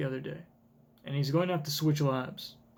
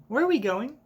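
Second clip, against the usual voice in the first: put-on lightly higher little-girl question; a hint of a child Bonnie; 7 semitones higher than usual. Bonnie asking her father where they are going, lightly childlike. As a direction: ask like a curious child. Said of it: "I think it's Bonnie saying".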